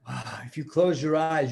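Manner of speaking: plain reading voice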